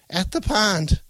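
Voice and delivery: put-on high, loud old-woman cry; yell. A narrator's reading. Grandmother warning of the pond, alarmed yell. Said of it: "Grandma yelled".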